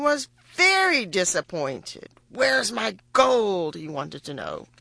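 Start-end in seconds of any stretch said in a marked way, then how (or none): 2.3-3.7 slow, drawn-out kingly demand. A narrator's reading. Disappointed king wants his gold from the girl, demanding. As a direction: demand it as an impatient king, dragging each word.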